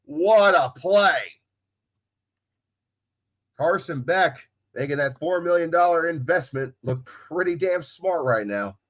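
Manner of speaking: sports announcer recapping the play. Real, not acted. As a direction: announce the score with broadcaster's poise and lift. Announces the score and kick.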